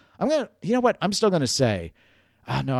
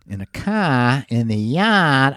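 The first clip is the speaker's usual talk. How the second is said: slow, drawn-out Boston vowels; unsure stab at a Boston accent; 3 semitones higher, 3 dB louder, slower than usual. Shown as a demonstration. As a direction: attempt Boston, dropping the r's. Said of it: "I don't even know that's Boston".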